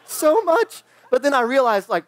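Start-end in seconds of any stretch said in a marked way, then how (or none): 0.0-0.8 much higher, squeaky excited inner voice; mock-giddy inner squeal; giddy longing. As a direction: gush like a kid who badly wants a turn. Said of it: "inside I'm like".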